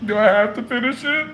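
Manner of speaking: mock reluctant child, mouth busy chewing; reluctant chewing kid. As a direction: whine like a kid made to finish a meal. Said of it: "like a kid that had to chew his meal".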